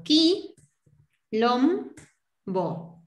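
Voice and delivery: "qui, lom, bo" separado con pausas; sílaba por sílaba. Shown as a demonstration. ("qui, lom, bo" en tres golpes espaciados)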